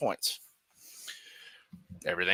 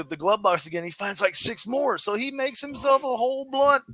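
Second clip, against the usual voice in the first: ordinary talk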